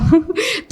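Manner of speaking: quick bright giggle, pitch jumping up; a little laugh at the memory; short high laugh. Real, not acted. The candidate recalls the interview judge and giggles.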